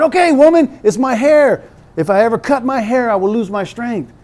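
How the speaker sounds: high, loud then fading surrender; exasperated Samson giving in. Retold or imitated. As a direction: give in, fed up. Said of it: "he finally said".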